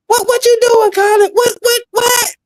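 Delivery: very high, squealed mock questioning; flustered girlfriend imitation. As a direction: mimic a girlfriend caught off guard, stammering high.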